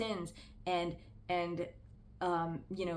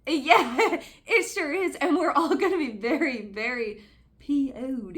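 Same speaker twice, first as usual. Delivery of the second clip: high, loud mocking laugh into a sarcastic jab; sarcastic outburst; 6 semitones higher, 10 dB louder, slower than usual. Real, not acted. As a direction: laugh it off loudly, then drag out "very P O'd". Sarcastic outburst about the sermon.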